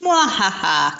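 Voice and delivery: evil villain cackle; hammed-up evil laugh; sneering panto villain. Joking about hoarding prizes.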